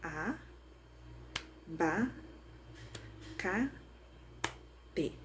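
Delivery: "A, ba, ca, te" with a long pause after each syllable. Shown as a demonstration. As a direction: clap out "A, ba, ca, te", one isolated beat each. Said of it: "vou bater palmas pra identificar as sílabas". ("A, ba, ca, te" sílaba por sílaba, com pausas longas)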